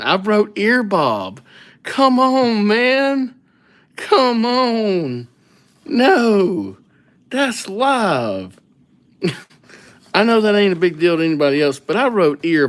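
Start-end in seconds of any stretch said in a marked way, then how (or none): 0.0-8.6 real outburst, much higher and louder; exasperated outburst. Real, not acted. Streamer realizing he misspelled his own name, exasperated high outburst. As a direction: howl at your own mistake.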